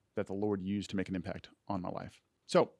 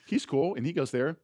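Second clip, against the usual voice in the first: naive younger self; 7 semitones higher, 3 dB louder than usual. Retold or imitated. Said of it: "I was like".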